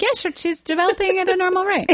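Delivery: laughing, squeaky impression of a proud mom; high, laughing mock parent. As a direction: toss off the line high and giddy. Mother voiced mockingly, laughing.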